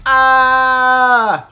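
loud, long scream; hammed-up terrified holler; panicked scream. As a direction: scream while running away. Fleeing the fangirl.

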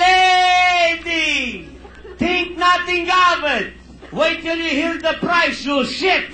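loud, high barking salesman; salesman shouting. Acted. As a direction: yell it at her like an outraged salesman. Salesman yelling punchline.

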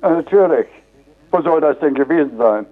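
normal talking voice

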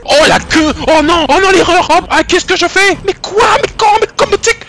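high frantic panicked cries; panicked yelling. Real, not acted. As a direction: panic as the enemy closes in. Panique face à un ennemi. (cris paniqués)

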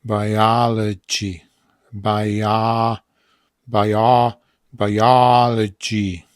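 "biology" stretched syllable by syllable; slow pronunciation demo. Shown as a demonstration. Teacher sounding out biology for learners, slow drawn-out pronunciation. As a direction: draw out each part of "biology". Teacher demonstrates word slowly in parts.

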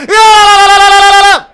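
loud drawn-out goal call; goal shout. Real, not acted. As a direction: yell the goal. Goal called.

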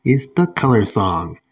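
loud, punchy presenter voice; upbeat, projected kids' show emcee; show host announcement. Acted. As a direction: announce the next clip with big energy. Host introduces music clip.

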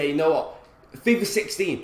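ordinary talk, nothing marked

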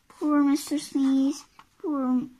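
ordinary delivery, nothing marked

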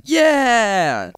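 high, loud mock cheer of triumph; excited yeah. Imitating a gamer who wins at the movie's end, cheering high and loud. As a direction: yelp it like a thrilled fan.

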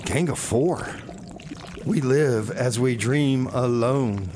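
ordinary delivery

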